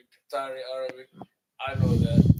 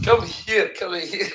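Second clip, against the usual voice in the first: higher-pitched call, breaking into laughter; official summoning; 6 semitones higher, 8 dB louder, much slower than usual. Acted. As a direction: imitate an officer beckoning, amused. Acted officer calling traveler back.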